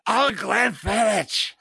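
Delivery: high, slow, drawn-out mock Scottish; hammed-up attempt at a Scottish accent; bad Scottish accent. Shown as a demonstration. Podcaster attempting Scottish on the co-host's dare, hammed-up accent. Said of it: "you gotta do it with a Scottish accent".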